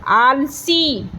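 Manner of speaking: "आलसी" very slowly, with a drawn-out "आ"; slow teacher's "आलसी"; long vowels. Shown as a demonstration. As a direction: stretch "आल", then hold the ee of "सी". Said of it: "आ को मैंने बड़ा बोला आ खींच के".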